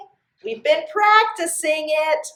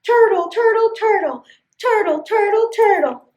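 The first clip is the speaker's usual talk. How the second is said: crowd cheer; 7 semitones higher than usual. Acted. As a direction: cheer like the whole crowd of animals. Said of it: "all the animals cheered".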